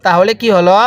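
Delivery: ordinary talk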